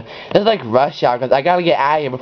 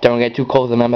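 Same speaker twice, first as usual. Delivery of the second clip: ordinary talk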